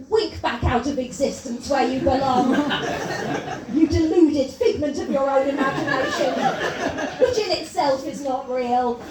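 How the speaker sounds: loud, high mock-lofty denunciation; grand mock child heckle. Child heckler dismissing the wizard, loud and lofty. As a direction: pronounce it like a tiny cosmic judge. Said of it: "A child heckler will effectively say".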